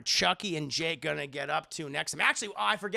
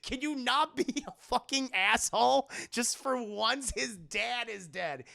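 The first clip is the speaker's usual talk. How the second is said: high loud scold, slowing down; scolding mom; 6 semitones higher, 3 dB louder than usual. Retold or imitated. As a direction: snap at Lucas, then drag it out.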